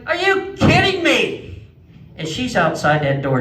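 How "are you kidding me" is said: high, slow, drawn-out disbelief; exasperated disbelief. Retold or imitated. Stuck in the bathroom, wailing high in disbelief. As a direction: wail it out in stunned disbelief. Alarm goes off mid-business.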